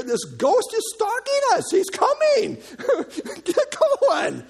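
high-pitched panicked cry; acted panic. Preacher as disciple stuck in the boat, high panic. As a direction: urge the rowers on in mock terror.

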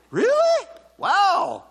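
very high, loud sarcastic surprise; hammed-up astonishment; mock amazement. Real, not acted. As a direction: exaggerate the disbelief, pitch soaring.

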